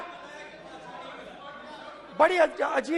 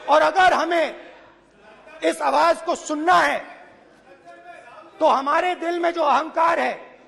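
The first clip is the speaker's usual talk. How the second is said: booming political rally speech. Real, not acted. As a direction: address the crowd like a rally stage. Addresses brothers and sisters rally-style.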